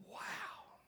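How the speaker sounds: hushed, unvoiced wow; awestruck whisper. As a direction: breathe it out in wonder. Impacted listeners struck with wonder.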